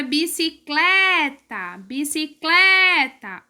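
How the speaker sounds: "bicicleta" slow, CLE stretched and strong; stress on CLE. Shown as a demonstration. Teacher giving pupils the first example, drawn-out CLE in "bicicleta". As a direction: lean long on CLE in each "bicicleta". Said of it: "o clé é mais forte".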